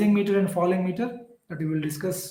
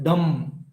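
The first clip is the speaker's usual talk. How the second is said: "dum" held as the strong beat; stressed syllable; 4 semitones lower than usual. Shown as a demonstration. Teacher showing the class the stressed "dum". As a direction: lean on "dum" and let it hum.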